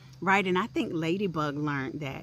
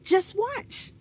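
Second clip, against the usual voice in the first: very high, bright-eyed teasing "just watch"; squeaky secretive butterfly twins; high twin voices; 3 dB louder than usual. A narrator's reading. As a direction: tease it up high like tiny butterflies. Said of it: "replied the butterfly twins".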